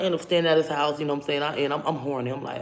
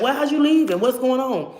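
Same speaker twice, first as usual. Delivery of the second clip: pleading cry; 8 semitones higher, 4 dB louder than usual. Retold or imitated. Chasing boyfriend begging.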